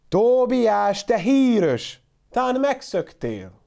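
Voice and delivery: high, loud worried call; old man shouting for his runaway goat. A narrator's reading. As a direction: holler the goat's name across the farm. Shepherd calls for lost goat.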